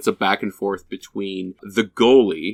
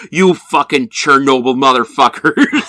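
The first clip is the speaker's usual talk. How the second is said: loud, raised-pitch indignant insult; riled-up goalie; 5 semitones higher, 6 dB louder than usual. Retold or imitated. Goalie riled up by two teammates, angrily insulting them.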